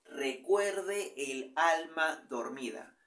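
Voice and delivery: slow, stretched reading to count syllables. Shown as a demonstration. Teacher slowly counting syllables for the class, drawn out. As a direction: draw the verse out syllable by syllable. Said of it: "Primer verso".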